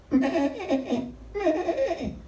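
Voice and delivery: high bleating "me, me" with a laugh; sheep bleat. Retold or imitated. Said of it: "pero estaban llorando".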